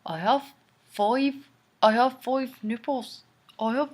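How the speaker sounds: mock Irish accent with broad vowels; attempted Irish accent. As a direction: ham up a clumsy Irish accent. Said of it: "I can't even do an Irish accent".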